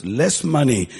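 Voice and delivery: ordinary delivery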